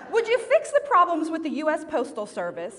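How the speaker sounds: high, mock-cross childlike demand; angry prayer. Son praying about the postal service, mock angry. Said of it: "in a really angry voice".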